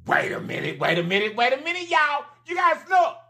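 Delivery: from normal to a high shout; building call. Real, not acted. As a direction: wait a minute, climbing into a shout. Calling viewers back, building.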